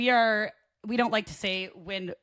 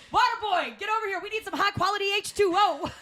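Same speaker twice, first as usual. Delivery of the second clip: bossy call; 11 semitones higher than usual. Retold or imitated. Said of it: "I was like".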